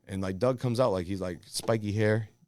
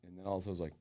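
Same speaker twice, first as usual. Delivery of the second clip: ordinary delivery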